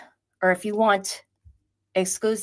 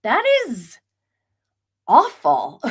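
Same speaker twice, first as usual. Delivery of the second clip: high, louder disbelieving what; retold shock, higher and louder; shocked outburst; 6 semitones higher, 6 dB louder, slower than usual. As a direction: recoil at the news. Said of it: "And I was like".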